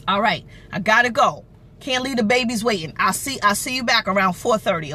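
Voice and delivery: normal talking voice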